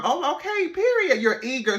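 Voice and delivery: high, sing-song sarcastic okay; mock surprised okay. Telling herself he's eager, in a high sarcastic voice. Her sarcastic inner reaction.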